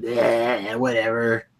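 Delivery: drawn-out, unimpressed yeah; dismissive. Retold or imitated. Co-host mocking own reaction from last year, dismissive. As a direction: brush it off with a long, dragged yeah. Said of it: "what did I say last year? I said".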